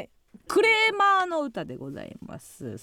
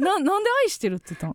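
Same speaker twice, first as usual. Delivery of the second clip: baffled; 5 semitones higher, 4 dB louder, much faster than usual. Real, not acted. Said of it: "co-host baffled by the mistake".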